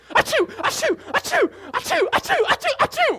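hammed-up rapid sneezing; comic sneezes. Acting out boy's seven sneezes.

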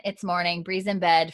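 ordinary delivery

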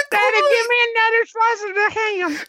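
put-on very high little-kid voice; pretend young son. Son butting into Dad's phone call, high mock child voice. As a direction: squeal it like a child begging Daddy. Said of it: "my son keeps getting on".

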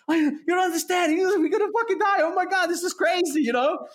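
high, loud panicked yelling, imitated; mock panic scream. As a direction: play the terrified flyer losing it. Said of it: "screaming at the top of his lungs".

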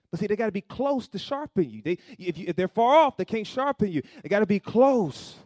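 loud rising sermon cadence, pressing the point; preaching at full force. Acted. Pastor to congregation, preaching at full force.

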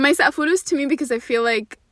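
ordinary delivery

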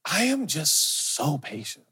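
soft, slow, smug little boast; quietly smug, slow; smug self-praise. Pretend. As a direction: savour your own saintliness. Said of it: "I'm like".

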